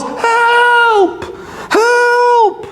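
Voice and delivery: long, high-pitched mock yell; drawn-out, very high cry for help; wolf yelling for help. A narrator's reading. Storyteller as choking wolf calling for help, yelled. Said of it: "so the wolf yells".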